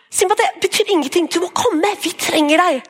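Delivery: pleading friend. Retold or imitated.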